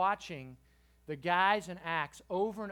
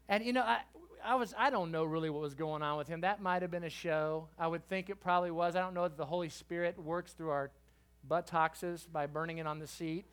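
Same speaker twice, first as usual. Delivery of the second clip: ordinary talk, nothing marked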